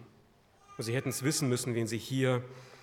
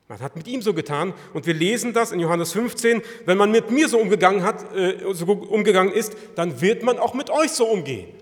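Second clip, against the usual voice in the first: loud, raised scripture warning; impassioned preaching; 5 semitones higher, 10 dB louder than usual. Real, not acted. Preacher warns believers they will suffer likewise, impassioned. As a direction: preach the warning with full force.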